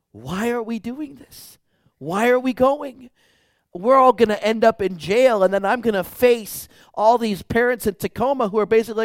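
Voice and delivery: high, panicked inner monologue; retold panic, pitch and volume climbing; freaking out. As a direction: build from disbelief into loud, high-pitched alarm. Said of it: "I am freaking out".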